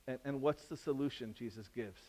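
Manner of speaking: ordinary talk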